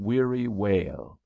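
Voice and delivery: plain reading voice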